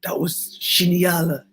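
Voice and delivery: slow, lightly French-tinged praise; French designer. Retold or imitated. Designer praising the model, slow, light French touch. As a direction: savour the word genial slowly. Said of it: "he's like".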